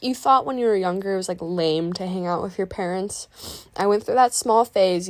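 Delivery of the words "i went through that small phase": usual speaking voice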